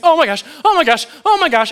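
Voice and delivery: high, loud, rapid mock panic; imitated girlish shrieking. Lineman afraid of the box, imitated panicky squeals. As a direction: squeal in hammed-up dread. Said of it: "shrieking like a little girl".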